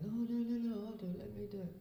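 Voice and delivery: imitating a mother who rushes to take over; mum insisting. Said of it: "my mum's going".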